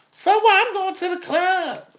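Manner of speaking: high, sassy woman impression. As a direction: toss it high and careless. Woman voiced in dialogue.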